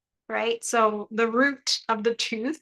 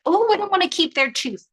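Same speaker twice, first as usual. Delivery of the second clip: mock prissy voice; 9 semitones higher, 6 dB louder than usual. Mocking the chat's reaction.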